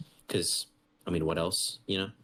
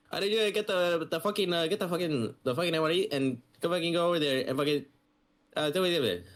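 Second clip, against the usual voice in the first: put-on much higher, rushed Micronesian accent full of swearing; mock Micronesian accent; 10 semitones higher than usual. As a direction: imitate the sergeant's thick Micronesian accent, barely understandable. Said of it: "he has a strong accent".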